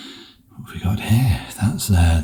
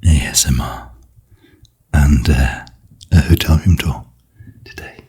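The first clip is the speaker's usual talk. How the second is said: ASMR whisper; 3 semitones lower, 5 dB louder than usual. Real, not acted. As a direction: whisper close to the mic, gentle. ASMR hotel room tour.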